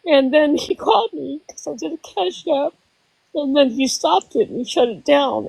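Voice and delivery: very high, strained tearful voice; choked up. Real, not acted. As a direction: struggle to keep talking through the upset. Choking up while recalling heartbreak.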